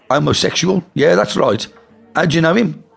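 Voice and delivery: startled question. A narrator's reading. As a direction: cover the shock fast. Toddy was taken back.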